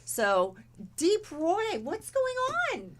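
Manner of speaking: normal talking voice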